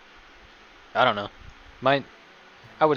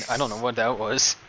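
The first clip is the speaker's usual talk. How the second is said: usual speaking voice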